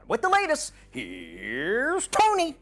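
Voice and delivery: high start, long stretched-out "here's"; hammed-up announcer intro. Host handing over to the film critic, big announcer intro. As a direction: draw out the introduction like a show announcer.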